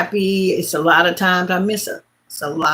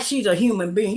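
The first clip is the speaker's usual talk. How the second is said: usual speaking voice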